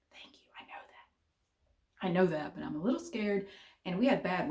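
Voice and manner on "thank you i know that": whispered aside. Retold or imitated. Answering her calm son.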